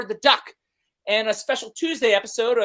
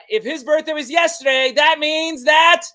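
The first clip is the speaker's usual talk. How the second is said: louder, higher build-up, slowing at the end; building excitement; 7 semitones higher, 8 dB louder than usual. Real, not acted. Host builds toward announcing his own birthday. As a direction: wind up toward the big reveal.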